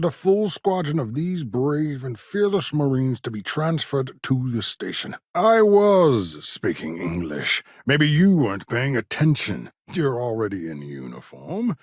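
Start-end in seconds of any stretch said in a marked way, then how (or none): 5.3-7.6 slow, drawn-out indignant retort; exasperated retort. Acted. Scientist to a listener who didn't follow, exasperated. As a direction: stretch it out, irked at being misunderstood. Irked at not being understood.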